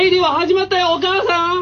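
high, bright calling out; excited call to mom. Pretend. Radio host calling his mom about the show.

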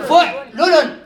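fa and first lam both with short u, «فعلل» as fu'lulun; due damme. Shown as a demonstration. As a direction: say «فعلل» with u after fa and after ayn-lam: fu'-lu-lun. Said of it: "ضمتان".

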